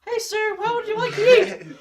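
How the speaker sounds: very high, loud squeaky server; restaurant server. Acted. Server takes Batman's order at Frickers, high put-on voice.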